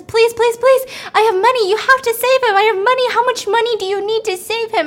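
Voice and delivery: high, loud, frantic pleading; desperate begging. Retold or imitated. Lottery woman begs the doctors to save him, frantic and high. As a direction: beg the doctors for his life.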